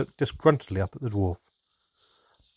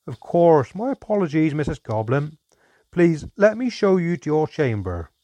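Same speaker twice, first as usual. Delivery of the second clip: louder, higher, brisk and hearty dwarf; a jolly host hurrying to be helpful; dwarf innkeeper showing guests upstairs, beaming; cheerful dwarf host; 6 semitones higher, 4 dB louder than usual. A narrator's reading. Dwarf cheerfully offers the room.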